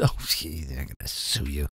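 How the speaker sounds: deadpan low scoff; low mock dismay. Real, not acted. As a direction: mutter it sarcastically. Sarcastic aside.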